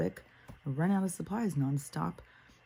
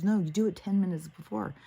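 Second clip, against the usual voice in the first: raised, insistent correction; insistent retort; 5 semitones higher, 4 dB louder than usual. Retold or imitated. He tells her when to call ahead, insistent. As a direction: lay down the rule in a retold argument. Retold argument with the other man.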